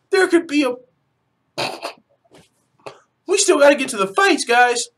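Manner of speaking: high, loud, breathless hype; overexcited shouting. Real, not acted. Fan reminding guys the fights are still tomorrow, overexcited.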